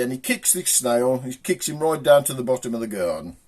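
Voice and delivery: ordinary talk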